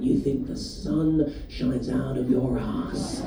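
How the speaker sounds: low, slow dad voice; deadpan old dad, slowed right down. Retold or imitated. Dad voiced in stand-up bit.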